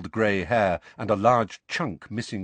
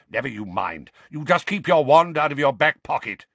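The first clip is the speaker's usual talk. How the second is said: gruff scolding, rising to loud; growl; 5 semitones higher, 5 dB louder than usual. A narrator's reading. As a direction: growl the order crossly. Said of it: "growled Mad-Eye".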